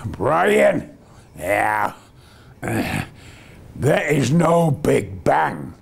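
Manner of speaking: slow, low impression of a rocket scientist; Zubrin. Scientist telling Brian there is no Big Bang, slow imitated voice. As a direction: imitate a scientist pronouncing slowly and weightily. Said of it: "that's the way Zubrin speaks".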